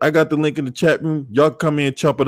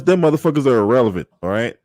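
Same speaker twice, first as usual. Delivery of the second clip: usual speaking voice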